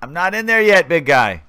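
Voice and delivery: mock exasperated reply; retold protest. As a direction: protest back as the buddy. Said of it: "And he's like".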